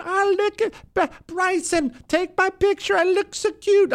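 very high, squeaky mocking voice; mock a preening selfie poser in falsetto; mock selfie-taker. Host mocking girlfriend asking Bryson for pictures, squeaky mock voice.

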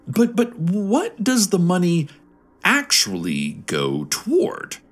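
stammering, lightly voiced iguana; talking iguana. A narrator's reading. Talking iguana questioning where money goes, lightly voiced and halting. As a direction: start high and halting, then drop and slow. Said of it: "asked one of Candy Longfellow's iguanas".

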